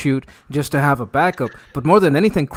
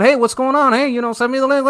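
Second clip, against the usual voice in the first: friendly; 9 semitones higher, faster than usual. Shown as a demonstration. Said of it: "be like that".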